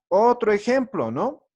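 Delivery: ordinary delivery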